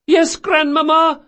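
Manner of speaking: high, loud answer; granddaughter calling back. Acted. Granddaughter upstairs to grandmother, high called reply. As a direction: call down from a window. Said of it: "came a voice from an open window".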